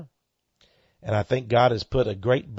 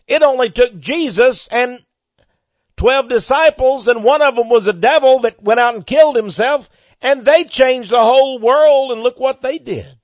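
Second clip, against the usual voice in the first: high, loud fiery sermon climax; preaching at full force; 11 semitones higher, 11 dB louder than usual. Acted. Radio preacher firing up his listeners, loud high preaching. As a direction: rouse the congregation with it.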